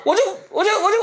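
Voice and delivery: usual speaking voice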